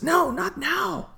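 high, slow villain voice. Acted. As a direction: snap it out as the villain. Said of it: "And Basil says".

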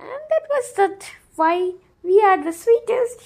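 high, proud and playful; playful high pitch. Real, not acted. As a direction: announce it with a grin. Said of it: "proud playful claim".

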